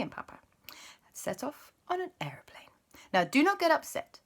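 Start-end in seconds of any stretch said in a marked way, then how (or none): 0.0-2.7 soft storytelling whisper. Acted. Aunt whispering the story to the boys. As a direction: hush it like a secret.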